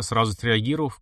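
ordinary delivery

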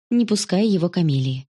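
usual speaking voice

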